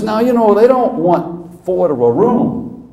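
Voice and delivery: high, slow mock coach; an old coach spelling it out; hammed-up drawling coach; imitating Skip. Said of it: "He says".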